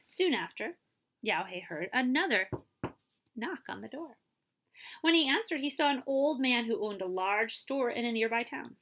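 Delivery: usual speaking voice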